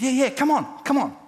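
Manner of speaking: high, loud eager urging; eager welcome. Retold or imitated. Pastor retelling how he urged the man to come, high and loud. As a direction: sweep him in with enthusiasm.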